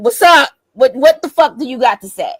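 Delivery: loud, high, confrontational challenge; angry demand. Retold or imitated. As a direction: snap back, daring him to talk. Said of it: "I'm mad now".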